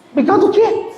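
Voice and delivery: incredulous squeak of a question; confused reply to an unexpected thank-you; baffled. Retold or imitated.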